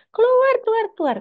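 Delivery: put-on very high, loud screaming child. Raging child screams at mother to get out, piercing shriek. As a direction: scream it like a toddler in full tantrum. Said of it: "anak saya teriak jerit".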